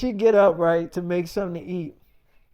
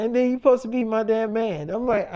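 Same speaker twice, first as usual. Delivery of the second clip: usual speaking voice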